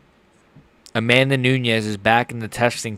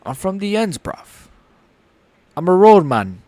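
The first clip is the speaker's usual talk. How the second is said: higher, put-on English roadman voice; English accent; 7 semitones higher than usual. Streamer practising after chat criticism, English accent. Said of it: "I gotta work on the English accent".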